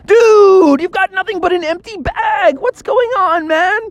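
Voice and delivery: very high, stunned dude voice; can't believe the bag is empty; incredulous friends. Acted. Friends staring at Froggy's empty marshmallow bag, very high disbelief. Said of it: "and everybody's like".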